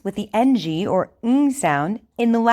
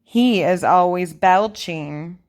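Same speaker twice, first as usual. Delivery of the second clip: over enunciating. Shown as a demonstration. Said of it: "Repeat after me".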